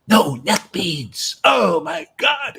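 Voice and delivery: loud, slow, scandalized mock gasp of horror; hammed-up shock, each word dragged out; appalled devotee. Sannyasi spotting a missing necklace, mock horror, slow and loud. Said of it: "completely changed his whole mood".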